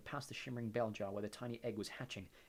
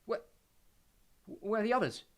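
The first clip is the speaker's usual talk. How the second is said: gasped; 10 semitones higher, 7 dB louder than usual. A narrator's reading. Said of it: "gasped Harry".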